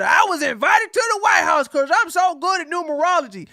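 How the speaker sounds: high, loud, quick mocking voice; hammed-up bragging impersonation; mocking quote. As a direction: imitate a boastful man mockingly. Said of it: "And he said".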